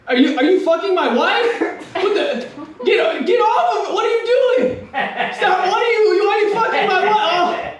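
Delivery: high, panicked husband outrage; distraught cuckold. Acted. Cuckolded husband catching a man with his wife, panicked and appalled. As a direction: stammer it high, as if catching them at it. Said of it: "my impression of a, of a, a cuckold experiencing".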